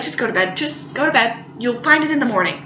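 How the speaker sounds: lightly voiced tired roommate; calm sensible roommate. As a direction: settle the silly ones down.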